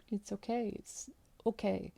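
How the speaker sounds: hushed, soft repeated reassurance; softly soothing. Retold or imitated. Stranger soothing a crying woman in the bathroom, hushed. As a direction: comfort her very softly. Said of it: "It's like".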